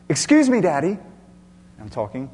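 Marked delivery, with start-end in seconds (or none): 0.1-1.0 high, loud opening that drops to a questioning end; polite child. Retold or imitated. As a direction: imitate a child politely interrupting Dad, once. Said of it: "she's very polite. She says".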